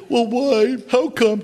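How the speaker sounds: high, mock whining questions; whiny complainer. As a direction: play the sniveling person asking for help. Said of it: "people will come to you constantly and say".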